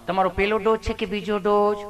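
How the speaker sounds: lightly voiced sweet nurse, gently asking; a hint of a tender nurse coaxing a patient; loving nurse. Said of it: "nurse બેને એવું પ્રેમથી પૂછ્યું". (પ્રેમથી પૂછતી નર્સનો હળવો અવાજ)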